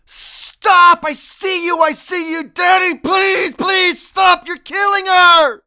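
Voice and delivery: put-on high, loud panicked child's scream; desperate screaming. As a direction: scream at him to stop. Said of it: "I'm going".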